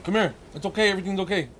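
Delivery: high, gentle reassurance; soothing a pet. Real, not acted. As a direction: coo to comfort an upset dog. Soothing dog upset by fake crying.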